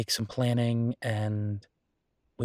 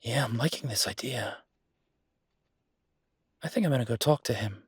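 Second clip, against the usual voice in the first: whispering to herself as the plan forms; whispered aside. Acted. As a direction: murmur it to yourself, pleased. Quiet aside while planning.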